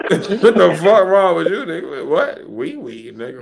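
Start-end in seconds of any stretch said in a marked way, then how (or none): none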